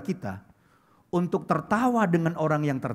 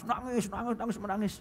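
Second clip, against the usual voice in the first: higher, softer mock whimper; mock weeping; 4 semitones higher, 9 dB quieter than usual. Preacher imitating a mistreated soap opera lead, mock weeping.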